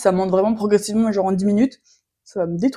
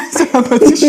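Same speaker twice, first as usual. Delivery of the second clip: laughing; 4 semitones higher, 5 dB louder than usual. Real, not acted. Laughing while serving chili.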